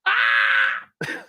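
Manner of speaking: a very high shout of alarm; sharp, high yelp; sudden high shout. Acted. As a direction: burst out high like a startled cry. Sudden exclamation.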